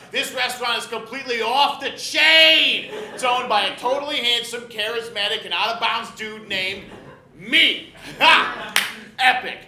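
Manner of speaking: loud, brash food-show host; TV host impression. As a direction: hype it up like a cable TV chef. Guy Fieri impression hosting show.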